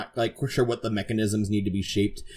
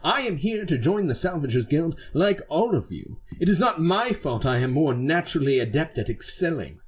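swooping, self-important knight voice; haughty knight, indignant; 6 semitones higher than usual. Acted. Knight excusing their own brilliance to crewmate, haughty. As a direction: protest with pompous pride.

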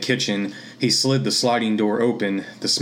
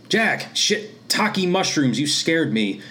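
startled shriek; 7 semitones higher, slower than usual. Acted. Mom startled naked in bathroom.

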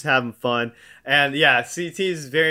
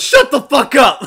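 imitating a sudden furious drunken shout, much higher and louder; retelling a drunk woman snapping at a heckler; drunken yell; 11 semitones higher, 10 dB louder than usual. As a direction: bellow it out of nowhere at him. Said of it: "And she just goes".

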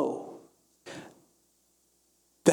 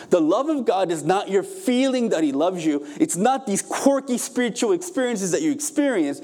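higher-pitched, driving sermon delivery; impassioned preaching; 6 semitones higher than usual. Real, not acted. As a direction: preach it with mounting urgency. Preaches at full force.

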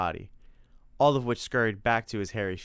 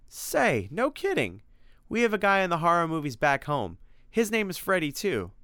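much higher, eager Tim; Tim, pleasantly surprised; 9 semitones higher, 4 dB louder than usual. Acted. Tim hears the monster's name is Freddy, eager surprise. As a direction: exclaim at a fun coincidence.

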